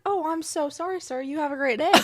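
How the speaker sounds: hammed-up overly sweet officer; apologetic cop. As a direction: play the cop melting in apology. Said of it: "And he was like".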